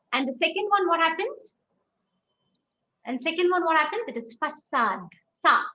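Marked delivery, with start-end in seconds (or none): 4.4-5.6 façade as "fa-sad", then "sa" alone; soft S sound. Shown as a demonstration. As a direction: say façade with an S, punching the repeated "sa". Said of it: "sa sound".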